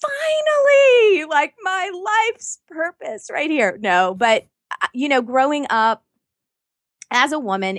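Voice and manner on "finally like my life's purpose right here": very high, joyous mock exclamation; hammed-up thrilled cry. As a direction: cry out as if finally set free. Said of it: "And I was like".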